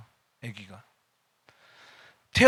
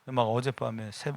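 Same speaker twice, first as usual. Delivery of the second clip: usual speaking voice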